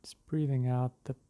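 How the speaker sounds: unhurried meditation voice with long pauses; guided meditation. Real, not acted. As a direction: ease them into the breath, drawing words out. Leads a breathing meditation.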